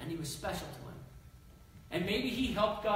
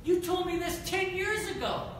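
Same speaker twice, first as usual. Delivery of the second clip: much higher, exasperated; frustrated cry; 11 semitones higher, 4 dB louder than usual. Acted. Abraham reminding God of the old promise, higher and frustrated. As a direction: throw it back at God, fed up. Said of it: "I can hear his frustration".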